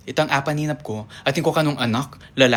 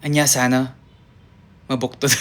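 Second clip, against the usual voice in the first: ordinary talk, nothing marked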